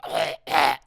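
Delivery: throaty retching hack like a dog coughing up a hairball; imitated hairball gag. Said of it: "I do remember him going".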